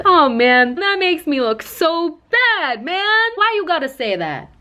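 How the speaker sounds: a mock-wounded drunk friend complaining; whiny drunk buddy. Drunk friend whining to his buddy, mock-wounded.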